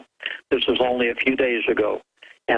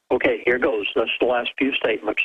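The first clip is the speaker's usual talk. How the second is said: normal talking voice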